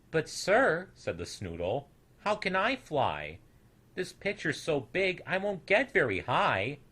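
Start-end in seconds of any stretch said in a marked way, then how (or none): 0.1-0.9 put-on high, louder little-boy protest; small snoodle protesting, pitched high. 2.2-6.8 small snoodle protesting, pitched high; small snoodle boy. Acted.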